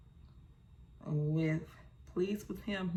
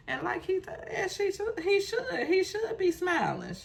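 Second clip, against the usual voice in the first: much higher pitched, pressing each "he should"; high emphatic insisting; 14 semitones higher, 3 dB louder than usual. Real, not acted. Viewer insisting the groom smile, pitched way up.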